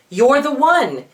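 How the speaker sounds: high, loud burst of joy; joyful exclamation. A narrator's reading. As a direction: blurt it out in happy relief. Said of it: "he exclaimed to Ariel".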